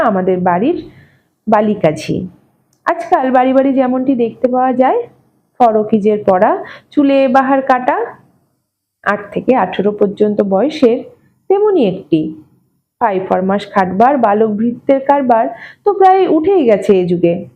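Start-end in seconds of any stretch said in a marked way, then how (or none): none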